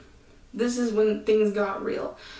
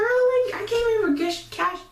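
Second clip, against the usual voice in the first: exasperated; 9 semitones higher, slower than usual. Real, not acted. Exasperated rant about the job.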